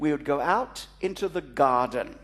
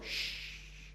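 hushed shh; 14 dB quieter than usual. Retold or imitated. Hushing as the breeze arrives.

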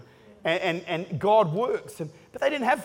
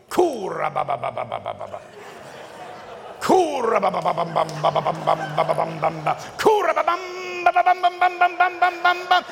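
lawnmower engine imitation; 6 semitones higher than usual. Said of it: "pulled that old lawnmower cord".

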